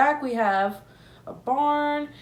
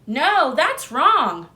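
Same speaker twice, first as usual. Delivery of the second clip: calling out; 4 semitones higher, 7 dB louder, much slower than usual. Acted. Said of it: "called out to stop them".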